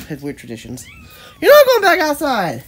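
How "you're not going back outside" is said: high, loud, drawn-out scold; scolding call. Real, not acted. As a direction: call out firmly to a pet heading out. Calling a pet back from going out.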